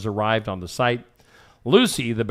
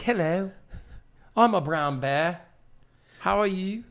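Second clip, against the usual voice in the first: higher-pitched British brown bear; British accent; 6 semitones higher, 3 dB quieter than usual. Acted. Host voicing escaped UK bears, in a high British accent. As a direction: put on a soft, high British voice. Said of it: "they talked with, like, a British accent".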